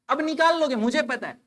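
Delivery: ordinary talk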